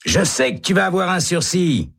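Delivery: high, eager prisoner voice; Hennessy. A narrator's reading. Prisoner urging hope on Baca. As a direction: cheer him on. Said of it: "dit Hennessy".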